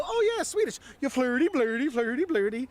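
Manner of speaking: high, hammed-up mock Swedish Chef gibberish; Swedish Chef impression. Tourists mocking Swedes with a high, hammed-up chef voice. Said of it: "chef impression".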